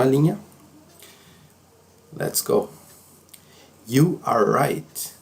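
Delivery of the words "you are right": "You are right" slowly, word by word; slow careful "You are right"; full uncontracted form. Shown as a demonstration. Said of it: "Ou, no inglês falado".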